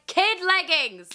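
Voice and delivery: high drawn-out clerk call; mock shop clerk exclaiming. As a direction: sing it out like a snooty clerk. Shop staff calling out.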